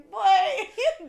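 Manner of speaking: high, loud, drawn-out comeback; hammed-up scolding through laughter; mock indignant retort. Woman about to tell off a man, mock indignant.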